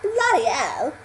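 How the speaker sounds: imitating a royal swearing in British English; royal British accent. Shown as a demonstration. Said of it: "talking like one of the royals".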